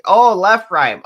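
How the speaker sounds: high, loud "oh" of realization; sudden realization. Real, not acted. Realizing she misheard.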